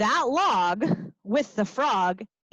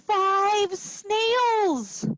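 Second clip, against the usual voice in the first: usual speaking voice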